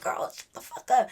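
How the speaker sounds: harsh whisper. Retold or imitated. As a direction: whisper it sharply to hush her. Hissing at friend to hush.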